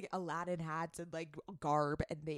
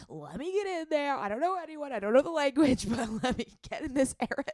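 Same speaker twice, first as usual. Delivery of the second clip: higher, eager imitation of Adam; playfully imitating an eager friend; high mock Adam voice; 8 semitones higher, 4 dB louder than usual. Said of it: "He is like".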